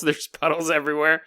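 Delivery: ordinary talk, nothing marked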